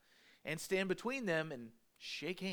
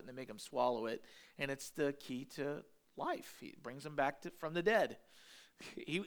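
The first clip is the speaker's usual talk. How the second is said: ordinary talk, nothing marked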